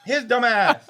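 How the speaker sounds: high pained laughing, tongue in milk; mouth burning from spicy food. Real, not acted. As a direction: laugh through the burn. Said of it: "keep my tongue in the milk".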